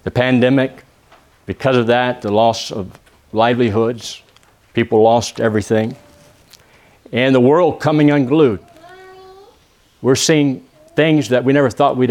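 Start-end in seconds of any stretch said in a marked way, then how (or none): none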